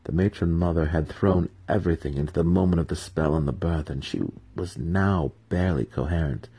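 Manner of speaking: plain reading voice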